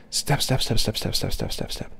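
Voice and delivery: soft, half-whispered rapid patter; pattering footsteps. A narrator's reading. Storyteller imitating dogs running across the field, soft patter. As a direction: tick the word off quietly like quick paws.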